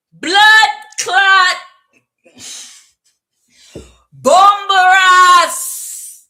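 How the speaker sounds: put-on loud, high Jamaican-accented shout. Tarot reader putting on the accent, shouted Jamaican outburst. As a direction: bellow it out in a big Jamaican accent. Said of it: "Don't make me do the accent".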